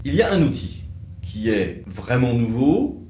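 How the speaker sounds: usual speaking voice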